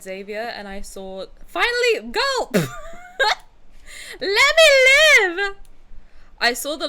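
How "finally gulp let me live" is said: high, loud excited squealing; fangirl squeal. Real, not acted. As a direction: squeal like a thrilled fan. Fangirling over long-haired character.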